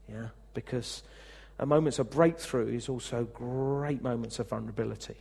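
ordinary delivery

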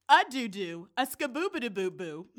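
normal talking voice

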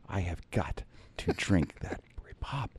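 slow, hushed mock desperation; desperate whisper. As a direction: whisper it like a parched man's solemn vow. Acted desperate plea to colleagues.

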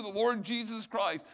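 usual speaking voice

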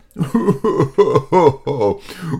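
loud mocking alien laugh; a big scornful laugh; alien leader laughing. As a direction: alien laughing at the little pig. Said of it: "The leader just laughed".

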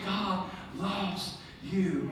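slow, hushed, heartfelt delivery; tender reassurance. Acted. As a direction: drop to a soft, lingering tenderness. Tender climax of acted reassurance.

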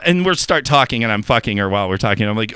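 normal talking voice

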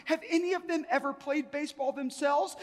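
high-pitched, baffled questioning; acted incredulous question. Visitor asks guide if they ever played, incredulous. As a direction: press the question in mounting disbelief.

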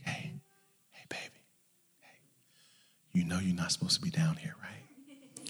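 put-on hushed, whispery mom waking her child; soft, coaxing near-whisper; gentle mother. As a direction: whisper it tenderly, as to a sleeping kid.

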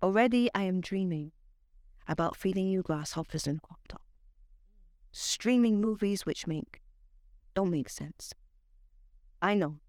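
measured spoken word delivery; poetry reading. Acted. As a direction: perform it like a slam poem, savoring lines. Spoken word poem recital.

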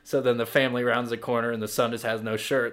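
usual speaking voice

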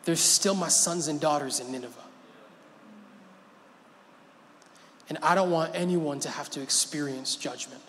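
tender God. Acted. Preacher voicing God defending his people, soft and tender. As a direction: say it like a father about his children.

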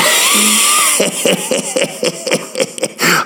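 sudden very high laugh; high shrieking laugh. Real, not acted. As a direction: burst out in a squealing laugh. Big laugh after jingle.

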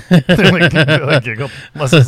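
talking through loud laughter; laughing. Real, not acted. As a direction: barely get the words out for laughing. Laughing while telling.

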